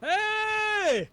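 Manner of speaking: very high, sustained holler; long call across a distance; yelling. Acted. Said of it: "Habes start yelling".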